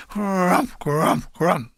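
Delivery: rolled, growled crump of shells; imitated mortar blasts. Narrator mimicking mortars landing on the hill, imitated blasts. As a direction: voice three mortar explosions.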